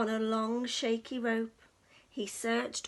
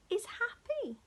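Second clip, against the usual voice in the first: whisper; 8 semitones higher than usual. A narrator's reading. Story narration whispered.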